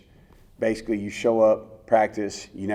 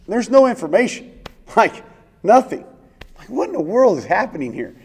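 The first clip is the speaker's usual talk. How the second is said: much higher and louder, laughing in disbelief; incredulous disbelief; 10 semitones higher, 7 dB louder than usual. Real, not acted. Player recalling the sudden call overseas, laughing disbelief. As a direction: still can't believe how little they told him.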